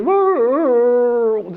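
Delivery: high mimicked dog howl-whine; hound dog howl. Storyteller voices the dog in the background, high whining howl. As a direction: howl like the dog lying on a tack. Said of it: "this howling".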